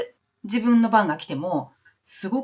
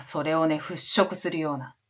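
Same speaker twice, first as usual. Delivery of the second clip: normal talking voice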